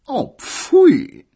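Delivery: high, loud disgusted exclamation; offended pfui. Acted. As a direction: recoil in prim disgust. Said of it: "das sagte immer gekränkt". (hoch und empört: Oh, pfui!)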